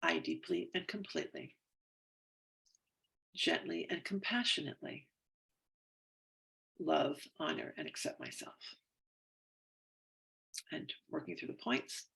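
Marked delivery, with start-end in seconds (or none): 0.0-8.5 tapping affirmation. Acted. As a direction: read it like a calm self-help script, easing off at the end.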